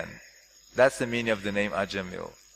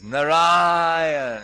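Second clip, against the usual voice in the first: long, loud called-out name; calling out; 4 semitones higher, 6 dB louder than usual. Retold or imitated. Dying man calls out for his son, loud and drawn out. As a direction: call the name out across the room. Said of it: "he's calling out".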